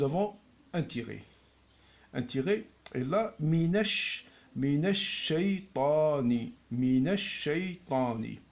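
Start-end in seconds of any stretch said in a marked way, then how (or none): 4.6-6.5 "minash shaytani" slow, shin held long; slow doubled shin. Shown as a demonstration. Teacher to students, "minash shaytani" slow with the shin held. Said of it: "Faites attention à la lecture". 6.7-8.4 "minash shaytani" slow, shin held long; slow doubled shin. Shown as a demonstration. Teacher to students, "minash shaytani" slow with the shin held. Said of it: "Faites attention à la lecture".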